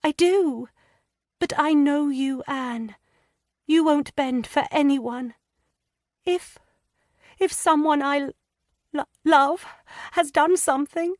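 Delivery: put-on high, hesitant girlish voice, stammering; a frightened young wife struggling to confess; young distressed woman. A narrator's reading. Lydia hesitantly confiding her fears to Anne.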